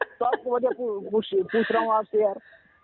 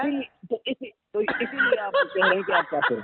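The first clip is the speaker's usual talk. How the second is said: laughing; 11 semitones higher than usual. Real, not acted. Arguing while laughing at accusation.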